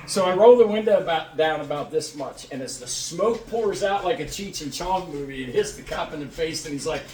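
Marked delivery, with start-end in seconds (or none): none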